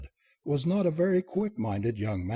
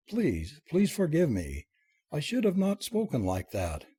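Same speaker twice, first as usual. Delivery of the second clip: plain reading voice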